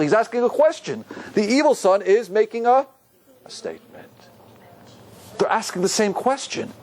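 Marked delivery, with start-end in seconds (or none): 3.5-4.1 very soft, low and hushed. Real, not acted. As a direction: drop to a near whisper. Drops voice to finish his point.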